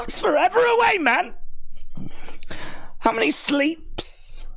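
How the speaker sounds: high, hammed-up wail of impatience; childlike whining.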